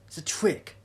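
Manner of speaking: loud, scornful snap; sharp disbelieving outburst; angry accusation. Acted. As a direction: shout it as a trick called out. Jess accuses the doctor.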